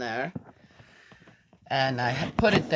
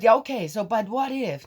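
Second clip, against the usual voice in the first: put-on higher, louder insistent child; granddaughter's voice; 8 semitones higher, 9 dB louder than usual. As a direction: push back like a stubborn kid. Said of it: "She said".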